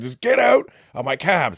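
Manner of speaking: mock yell, kicking passengers out; retold exasperated yell. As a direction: act out a cabbie losing patience. Cabbie loses patience after their pleading.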